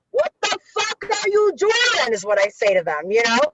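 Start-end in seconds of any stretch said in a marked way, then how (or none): 0.1-2.1 high, drawn-out challenge; emphatic confrontation. Retold or imitated. Telling what she says to the guys, emphatic confrontation. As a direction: stretch each word out in exaggerated disbelief.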